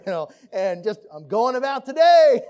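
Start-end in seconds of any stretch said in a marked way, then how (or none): 1.1-2.4 much louder and higher, a mock carefree exit; breezy brush-off. Spouse shrugging off a partner who says they're fine, loud mock-casual. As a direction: toss it off loudly as if nothing's wrong. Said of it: "But you were tempted to be like".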